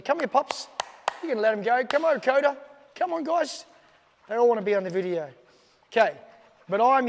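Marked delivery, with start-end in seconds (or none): 0.0-3.6 high, loud call to the pups; calling the dogs. Real, not acted. Trainer calls his dogs onto the video, high and loud. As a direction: coax the dogs over, pitched up.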